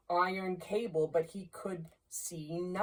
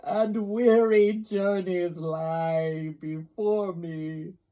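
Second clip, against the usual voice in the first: anguished ghost; 5 semitones higher, 7 dB louder than usual. Acted. Ghost's speech in narrated story.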